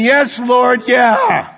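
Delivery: high ringing shout of praise. Acted. Preacher voicing the church's reply, shouted praise. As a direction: cry out your yes to the Lord. Congregation's answer voiced aloud.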